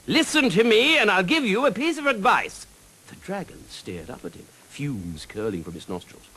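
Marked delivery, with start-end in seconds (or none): 0.1-2.7 high, loud bold knight's call. Acted. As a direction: muster all the courage you can. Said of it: "boldest voice he could muster".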